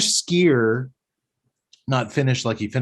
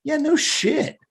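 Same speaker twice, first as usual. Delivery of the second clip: mock high squeak; 13 semitones higher, slower than usual. Mocking aside, very high pitch.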